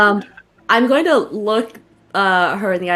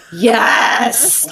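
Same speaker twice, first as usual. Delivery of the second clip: excited dog yes. Pretend. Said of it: "are gonna be like".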